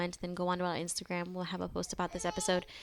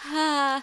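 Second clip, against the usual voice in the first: high, loud mock whine; impatient child's cry; 8 semitones higher, 8 dB louder than usual. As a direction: imitate a kid's impatient outburst. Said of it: "he's so impatient".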